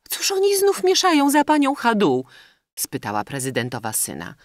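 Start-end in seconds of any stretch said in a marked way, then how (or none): 0.1-2.2 high, loud lady's question; high-voiced indignant query; puzzled society lady. A narrator's reading. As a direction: ask like a puzzled society lady, pitched right up.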